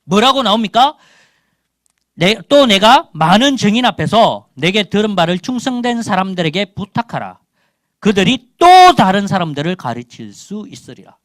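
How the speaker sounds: loud, high-pitched pulpit delivery; forceful preaching. Real, not acted. Preaches on a Bible verse.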